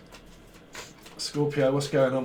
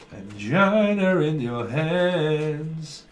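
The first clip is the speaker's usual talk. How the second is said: drawn-out angina; much slower than usual. Shown as a demonstration. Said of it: "drawn out angina".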